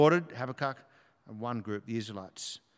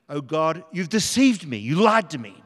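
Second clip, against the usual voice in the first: loud, raised cry of betrayal to God; anguished accusation; 3 semitones higher, 8 dB louder than usual. Acted. Preacher voices Jeremiah complaining to God, anguished accusation. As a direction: throw the accusation up at God, loudly. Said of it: "that's how he felt".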